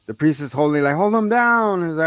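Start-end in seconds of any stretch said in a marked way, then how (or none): 1.0-1.9 higher-pitched retold command; mock urgent order. Retelling the priest holding the boy, mock urgent order. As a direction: call it out as the struggling priest.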